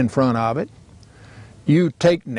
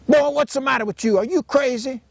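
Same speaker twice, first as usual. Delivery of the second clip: put-on much higher, fast, indignant old Southern father; daddy scolding; 12 semitones higher than usual. Old father scolding his son, high and fast with indignation. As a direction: snap at the boy like an outraged daddy.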